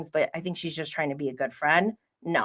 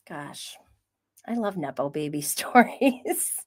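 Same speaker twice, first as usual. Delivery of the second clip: ordinary delivery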